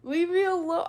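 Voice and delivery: high, loud playful complaint; mock-upset yell. Streamer teased for saying railroad, mock-upset yell. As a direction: burst out in mock protest.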